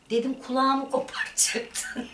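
high, laughing through the words; laughing while telling. Real, not acted. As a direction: laugh out the memory of the torn headphones. Recounting mother tearing headphones off. (gülerek anlatıyor)

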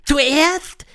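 high, loud witch voice; high-pitched, theatrical witch leader; Grand High Witch. A narrator's reading. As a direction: lecture the coven in a high, imperious voice.

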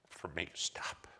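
a soft whispered plea; pleading whisper. Retold or imitated. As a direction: beg him gently under your breath. Mom pleading with her son.

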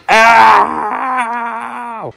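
mock hurt cry; fake ow. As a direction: pretend pain yelp. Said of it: "It was fake".

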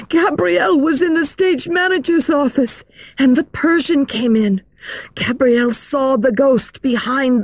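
put-on high, breathless girlish voice; excited girl voice. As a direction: tell it like a scared schoolgirl. Jeanne's story.

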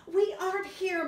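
squeaky high little cub; high tiny bear voice. Acted. Smallest snow bear teasing Mommy, high and cheeky.

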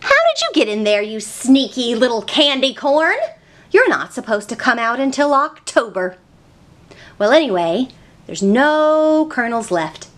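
normal talking voice

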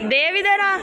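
high laughing call to Dev. Real, not acted. Vlogger calling Dev over to the camera, laughing call.

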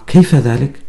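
surprised question. A narrator's reading. Shiyar questions Jowan about the age gap, astonished. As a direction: ask it in disbelief. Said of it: "يسأله باستغراب".

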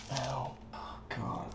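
usual speaking voice

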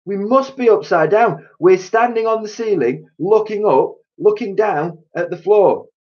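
high, loud panicked voice. A narrator's reading. Mister Twit explaining to his wife, high loud panic. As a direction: babble in alarm. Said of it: "gasped Mister Twit".

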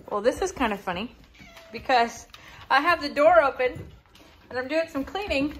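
ordinary talk, nothing marked

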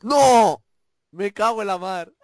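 loud, high cry of dismay; frustrated shout. Real, not acted. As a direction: yell out in frustration. Just killed in the game.

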